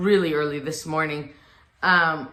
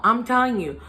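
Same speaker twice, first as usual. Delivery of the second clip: normal talking voice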